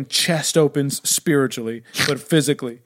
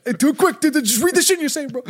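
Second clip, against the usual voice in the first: high, mocking bro voice, pitched up; mock dude voice; 11 semitones higher than usual. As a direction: mimic a careless bro egging him on. Mocking the gospel writer.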